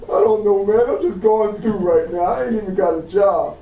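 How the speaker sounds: a hint of a discouraged man; imitated glum reply. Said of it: "Walking down the street".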